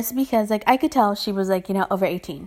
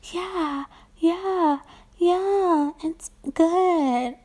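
high, sing-song mock of her voice; nasal annoying voice; 7 semitones higher, much slower than usual. Mocking the rude customer's high nasal voice. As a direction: parody the girl's whiny voice. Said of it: "the most nasally, annoying voice".

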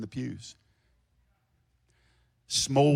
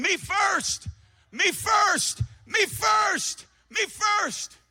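loud high whining chant of me first; hammed-up selfish cry; mock selfish world; 4 dB louder, much slower than usual. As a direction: shout it like a greedy child. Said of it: "the world is saying".

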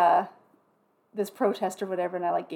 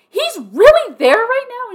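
high, loud and slow; can't believe what she's hearing; stretched-out shocked question; incredulous; 13 semitones higher, 11 dB louder, slower than usual. Retold or imitated. Disbelief on the phone to sister.

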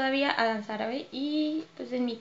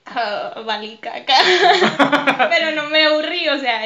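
laughing; 2 semitones higher, 9 dB louder than usual. Real, not acted. Laughs at her own story.

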